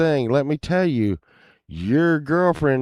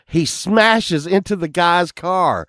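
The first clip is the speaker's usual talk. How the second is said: usual speaking voice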